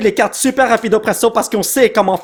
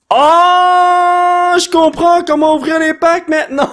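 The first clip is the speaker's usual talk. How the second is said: excited discovery; 9 semitones higher, slower than usual. Real, not acted. Finally figures out opening packs.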